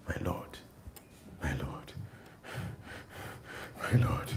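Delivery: soft, breathless, pleading; humble plea. Retold or imitated. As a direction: beg softly at his feet. Hunter bowing, begging forgiveness.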